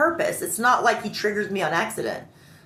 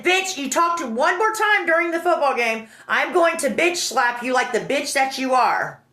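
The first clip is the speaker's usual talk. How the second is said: raised, emphatic mock threat; retold threat; 5 semitones higher than usual. Friend threatening her during the game, angry. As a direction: imitate an angry man warning someone.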